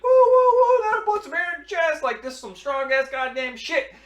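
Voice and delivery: hammed-up hollering after a strong shot; hooting big man. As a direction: whoop like the booze burns your chest. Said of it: "He's like".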